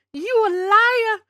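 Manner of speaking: high, loud, slow accusation; playful shout. Acted. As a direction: yell it at chat teasingly.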